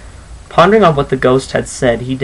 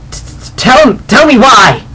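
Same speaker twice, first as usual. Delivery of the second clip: high, louder, stammering in fear; trembling; 10 semitones higher, 5 dB louder than usual. Acted. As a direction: stutter it out, shaking.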